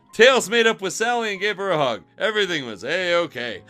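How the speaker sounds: normal talking voice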